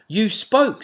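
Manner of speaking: put-on high, loud child's retort; insistent owlet. A narrator's reading. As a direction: owlet catching daddy out.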